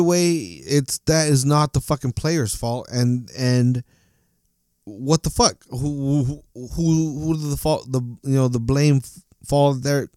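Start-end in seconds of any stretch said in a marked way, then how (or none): 4.8-10.1 loud, sputtering frustration; real anger, words tripping over each other; upset rant. Real, not acted. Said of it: "That's how fucking upset I am".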